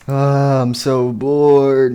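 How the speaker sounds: loud, drawn-out whine of boredom; bored groan. Acted. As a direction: groan it long and slow, acting bored. Springtrap sits down bored.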